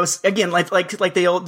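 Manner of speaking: ordinary delivery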